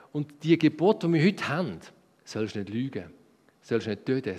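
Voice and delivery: ordinary talk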